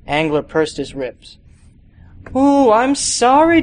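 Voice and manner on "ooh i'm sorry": high, loud mock apology, slowed; mock-sorry sneer; sarcastic. Sarcastic jab at the detective.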